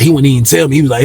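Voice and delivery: normal talking voice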